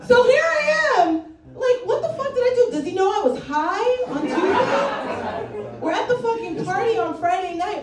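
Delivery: high, loud comic panic; mock panic. Recalling dreading Monday's meeting all weekend, mock panic.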